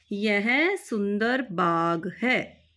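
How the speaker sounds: यह सुंदर बाग है said slowly and clearly; slow dictation. Shown as a demonstration. Teacher dictating the translation slowly. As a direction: spell it out slowly for the class. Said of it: "repeated slowly for students".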